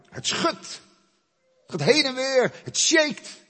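shouted high over the music; shaking temple. Acted. Preacher booms about the temple shaking. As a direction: cry out as the temple shakes.

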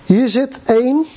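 ordinary delivery

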